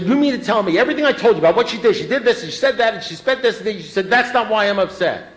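high, loud, rushed sputtering rant; indignant tirade. Retold or imitated. Man retells arguing with his sponsor about wife, indignant tirade. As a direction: pile up the grievances in a yelled, disbelieving question. Retold furious reply to sponsor.

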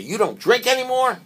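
high, loud incredulous question; mock disbelief. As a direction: act stunned that he quit drinking. Guest amazed at not drinking.

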